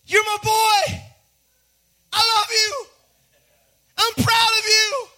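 high, loud proud-father holler; cheering out each phrase at high pitch; exultant proud shout. Acted. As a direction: burst out with fatherly pride, short phrases. God bursting with pride over Jesus.

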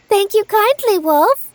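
put-on high, sweet girl voice; little girl voice. A narrator's reading. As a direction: lift up into a small girl. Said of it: "said the wolf".

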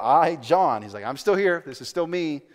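loud, insistent self-announcement; quoting a writer who reminds everyone he's there; John speaking up. Retold or imitated. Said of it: "He speaks in the first person".

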